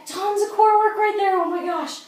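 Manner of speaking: high, loud, thrilled; excited outburst. Real, not acted. Coach to viewers after a tough move, excited and loud. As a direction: blurt it out, buzzing from the effort.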